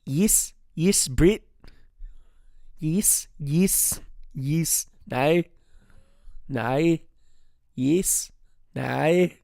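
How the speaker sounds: put-on single words tried out in a Kiwi accent; practising New Zealand accent. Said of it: "When I try to think of New Zealand".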